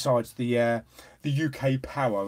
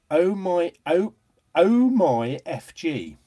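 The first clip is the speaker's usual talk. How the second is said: ordinary delivery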